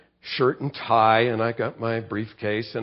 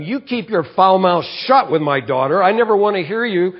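retold shout at the neighbor, higher and louder; retold angry yell; 6 semitones higher, 6 dB louder than usual. Pastor reenacting yelling at cussing neighbor, retold angry shout. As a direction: replay telling off the neighbor, raised and sharp. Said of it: "I just yelled at him like that".

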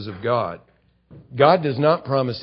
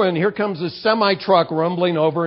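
ordinary delivery

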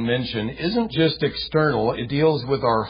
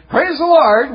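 high, loud mock praise; fake cheer; 12 semitones higher, 9 dB louder than usual. Preacher mocking hollow praise at a funeral, high and loud. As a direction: chirp it with a forced smile. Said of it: "go around with a smile on your face saying".

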